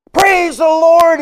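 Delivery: very high, very loud, slow shout of praise; sudden yell. Retold or imitated. Hitchhiker in the truck suddenly yelling out his joy, full-throated shout. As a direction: belt it out like a man overcome. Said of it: "I may scare you what I'm about to do".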